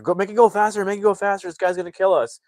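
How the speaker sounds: high, loud, urgent urging; mock panic. Narrator as family chased by truck, mock panicked urging. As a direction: imitate frightened passengers yelling.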